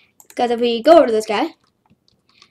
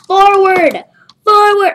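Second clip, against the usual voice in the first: loud, higher yell; urgent command; 7 semitones higher, 7 dB louder, slower than usual. Real, not acted. Gamer yelling at his ragdoll to move, loud urging shout. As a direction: urge him on.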